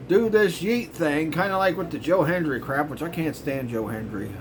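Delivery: ordinary talk, nothing marked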